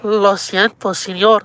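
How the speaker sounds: high, loud, hammed-up Spanish plea; mock pleading Spanish. Final pleading climax.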